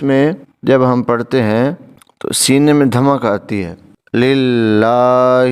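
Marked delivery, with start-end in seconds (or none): 4.1-5.4 للہ with the la held long, very slow; elongated recitation. Shown as a demonstration. Teacher showing the long vowel in للہ, very slowly. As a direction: draw out للہ, holding the long a. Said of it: "یہ بھاری ہا ہے".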